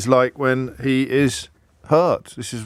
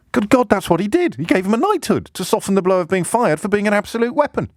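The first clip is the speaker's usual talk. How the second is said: incredulous outburst; 10 semitones higher than usual. Real, not acted. Outraged punchline after impression.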